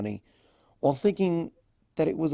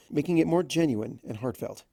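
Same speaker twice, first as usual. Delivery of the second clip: ordinary delivery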